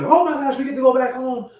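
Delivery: high, loud mock delight; mock happy excitement. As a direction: imitate people thrilled to go home. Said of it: "they all happy".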